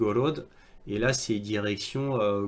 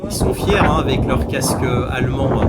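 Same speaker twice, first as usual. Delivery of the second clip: sarcastic emphasis; 10 dB louder than usual. Real, not acted. Mocking soldiers' pride in German helmets.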